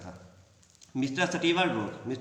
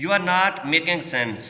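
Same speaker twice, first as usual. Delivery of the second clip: each word drawn out slowly for the class; teacher reading a quote aloud slowly; 4 semitones higher, 5 dB louder, much slower than usual. Shown as a demonstration. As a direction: read it out slowly and clearly.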